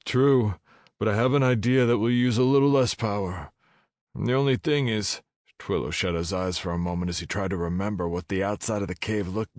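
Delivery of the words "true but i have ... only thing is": hero voice. Acted. Hero replies to dragon.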